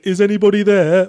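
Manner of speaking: eerie, distant ghostly call into an empty house; a spooky voice that seems to come from nowhere; disembodied ghost voice. Retold or imitated. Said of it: "a disembodied voice occasionally going".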